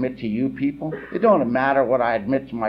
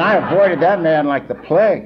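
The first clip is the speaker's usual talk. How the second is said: louder, laughing through the words; 5 semitones higher, 8 dB louder than usual. Real, not acted. To the meeting about avoiding Charlie, laughing. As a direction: chuckle it out as you admit it. Laughing while recalling old man.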